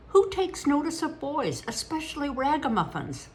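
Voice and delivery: put-on eager boy arguing. A narrator's reading. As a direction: keep pressing the point eagerly. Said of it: "Hecate argued eagerly".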